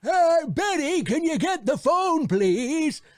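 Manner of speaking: put-on high, slow old man calling to his wife; a quavery old husband asking for the phone; high-pitched old husband calling Betty. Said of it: "old married couple demo".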